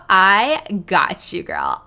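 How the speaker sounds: put-on slow drawn-out Valley Girl accent. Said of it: "You want a Valley Girl accent".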